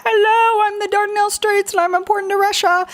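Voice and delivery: high, fast, cartoonish voice of the Dardanelles Straits; voicing the strait. Shown as a demonstration. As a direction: speak as the strait itself, chirpy and quick. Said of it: "So everyone go".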